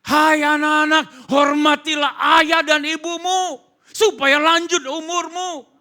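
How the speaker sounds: high, thundering proclamation; preaching at full force. Acted. Preacher charging children to honour parents, high thundering shout. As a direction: shout the commandment out to everyone.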